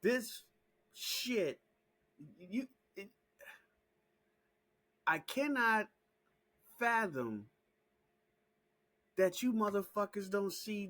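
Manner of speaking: high, loud disbelief at the viewers; exasperated shouting. Real, not acted. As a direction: shout it, unable to fathom it.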